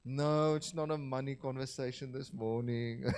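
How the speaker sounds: ordinary talk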